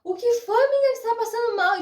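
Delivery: high, fast, alarmed asking what's wrong; mother-in-law bursting in, high and frantic; desperate mother-in-law. Retold or imitated. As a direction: rush in panicked for the daughter-in-law. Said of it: "minha sogra subiu desesperada".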